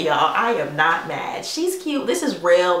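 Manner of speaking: ordinary delivery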